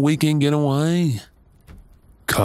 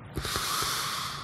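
soft unvoiced sigh; blissful sigh; 13 dB quieter than usual. Partner scratching the scalp, soft blissful sigh. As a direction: melt into it with a breathy exhale.

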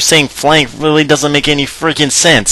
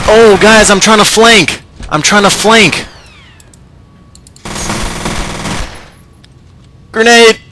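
calling out; 8 semitones higher than usual. Real, not acted. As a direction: shout it across to teammates. Calling out to teammates.

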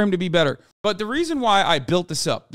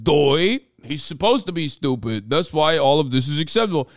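lightly put-on dimwit voice; a hint of a dopey oaf; mock dopey voice; 3 semitones lower than usual. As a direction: play a dim apologist, mockingly. Mocking a dopey excuse.

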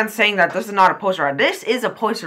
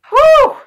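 short, high, loud whoop; excited whoop; 8 dB louder than usual. Real, not acted. Viewer reacting to a basketball play, excited whoop.

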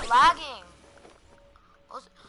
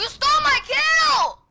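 high, loud angry shout; angry yell; 12 semitones higher, 7 dB louder than usual. Real, not acted. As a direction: yell it at the kill stealer. Angry at teammate.

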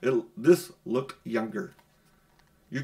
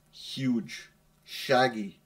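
usual speaking voice

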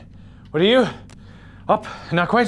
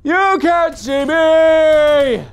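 extremely slow, high, held-out wail; very high drawn-out taunt; 10 semitones higher, 4 dB louder, much slower than usual. Pretend. Player taunting an enemy, very high drawn-out wail. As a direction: wail it high, holding the vowels. Taunting, louder and higher take.

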